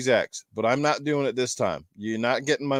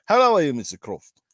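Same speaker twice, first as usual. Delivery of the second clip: a mock British "How are you, Mister Croft?"; an amateur stab at a British accent; attempted British accent; 3 dB louder than usual. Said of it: "I suppose that was British".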